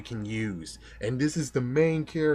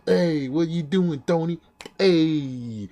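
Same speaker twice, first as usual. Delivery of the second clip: mocking fifties New York tough-guy accent, louder and higher; 5 semitones higher, 5 dB louder, slower than usual. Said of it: "you gave him a fifties accent of".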